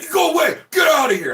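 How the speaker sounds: high, loud yell to clear him off; imitating the neighbour bellowing at him; angry shout.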